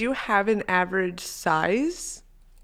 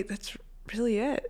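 whispered; 3 semitones higher, 7 dB quieter than usual. Real, not acted. Trailing whisper.

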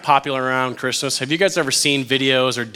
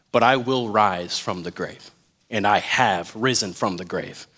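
low, measured, softened voice; quiet, weighty promise; solemn declaration; 6 semitones lower, 4 dB quieter than usual. Acted. Preacher voicing Jesus to the doubting heart, solemn.

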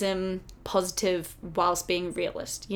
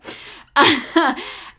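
loud, high laugh; 5 semitones higher, 5 dB louder than usual. Real, not acted. Host tickled by her own accent slip, loud laugh. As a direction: burst out laughing at yourself.

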